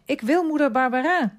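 put-on high, loud child's demand; distressed protest. A narrator's reading. Boy demanding his mother as he's sent away, distressed shout. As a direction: cry out in protest, pitch leaping up.

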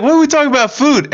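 high, loud baffled host voice; confused Steve Harvey. Retold or imitated. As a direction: ask it high and bewildered. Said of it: "He's like".

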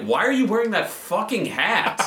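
high, needling retort; mock heckling. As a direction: act out pestering someone, incredulous. Acts out himself heckling the stranger.

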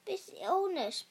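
hoarse, croaking sick king; croaky voice. A narrator's reading. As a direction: croak it out, voice failing. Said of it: "He croaked".

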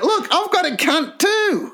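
very high mock girly voice; drunk falsetto boast. Friend mocking the drunk party stunt, very high falsetto. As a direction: squeal it high like a drunk prankster. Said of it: "and went".

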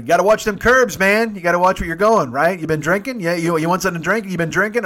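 imitating playful questioning from the crowd; teasing young dudes. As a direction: heckle him like a rowdy bystander. Young dudes teasing him, acted.

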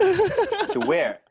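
high, loud startled "to where?"; surprised. Retold or imitated. Friend startled by a phone call, mock-surprised yelp.

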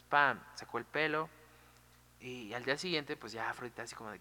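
ordinary delivery, nothing marked

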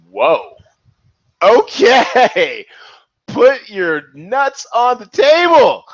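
high, loud, laughing excitement; excited laughing. Real, not acted. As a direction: cheer the bold bet on, laughing. Host thrilled by bold pick.

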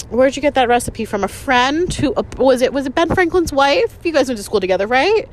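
mock sarcastic. Imagined jab at boyfriend's mother's age guess, mock sarcastic. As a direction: play innocent to land a sarcastic jab. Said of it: "sarcastic comments were just ready".

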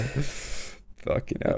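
breathless slow whisper; real disbelief, under the breath; whispered fucking hell. Real, not acted. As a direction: drop to a stunned whisper. Reacting in disbelief.

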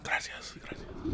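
breathy whispered thanks. Real, not acted. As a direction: whisper a quick thank you. Quiet thanks while drinking.

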